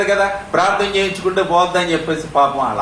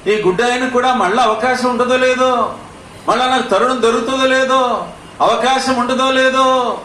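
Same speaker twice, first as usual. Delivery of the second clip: high, pressing voice of the blind man's worry; high urgent preaching; 6 semitones higher than usual. Acted. Preacher voicing the blind man's anxious hope, high and pressing.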